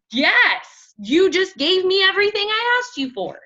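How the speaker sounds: mock thrilled client; delighted yes. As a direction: gush with relief. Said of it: "They're gonna be like".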